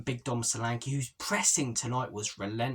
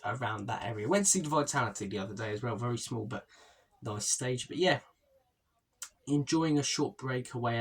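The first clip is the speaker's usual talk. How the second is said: ordinary talk, nothing marked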